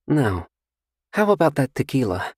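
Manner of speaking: coy and sure of herself; seductive stranger. Acted. Seductive stranger asks Carter about his drink, coy. Female character voice.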